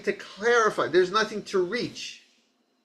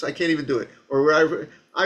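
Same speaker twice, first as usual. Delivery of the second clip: ordinary talk, nothing marked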